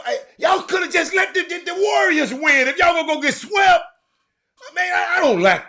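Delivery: high, loud half-laughing shout; laughing outburst. Real, not acted. Fan laughing and shouting at Lakers fans over the sweep. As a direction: crack up while ranting at them.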